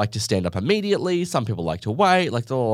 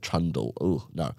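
"trundle" said quieter and lower, with a falling, disgusted tone; distaste for the word; 7 semitones lower, 8 dB quieter than usual. Shown as a demonstration. Narrator recoiling from the word "trundle", disgusted. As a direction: let "trundle" drop out of your mouth like something sour. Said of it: "I don't like that word".

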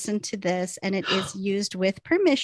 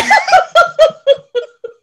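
high, loud shrieking laugh; helpless laughter; 14 dB louder than usual. Real, not acted. Podcaster collapses after the growl attempt, loud laughter. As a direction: lose it completely laughing.

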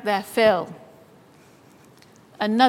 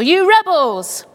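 a raised cry of "You rebels!", pitched up; shouted; 5 semitones higher, 4 dB louder than usual. Retold or imitated. Moses to God's people, a lightly retold shout. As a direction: call it out sharply but not at full force. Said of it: "He shouted at God's people".

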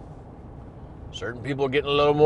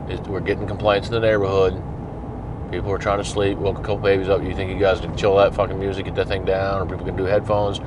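calm and reasonable; 6 semitones lower, 4 dB quieter than usual. Retold or imitated. Officer politely asking the party host to turn it down, calm and low. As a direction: lower and softer, steady and easygoing. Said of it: "very calmly and reasonably say".

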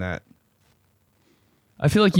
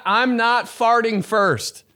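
high, slow, drawn-out declaration; dramatic vow; 11 semitones higher, much slower than usual. Acted. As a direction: stretch it like a solemn oath.

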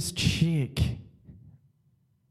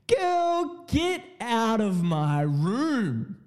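put-on high squeal on girl, then slow get out; mock sassy order, stretched out slow; telling her off; 5 semitones higher, much slower than usual. Ordering the prostitute out of his room, mock sassy. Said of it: "So then I'm like".

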